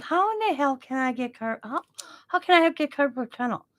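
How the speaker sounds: high, incredulous questioning; puzzled high-pitched objection; retold disbelief. Retelling her disbelief at diagnosis.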